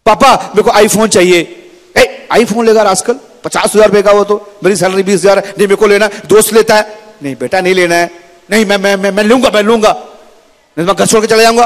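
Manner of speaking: high, insistent pleading back and forth; stubborn kid versus worried dad; whiny bickering. Acted. Son demands iPhone, father protests. (ऊँची, ज़िद भरी बहस)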